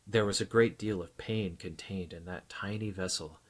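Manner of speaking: plain reading voice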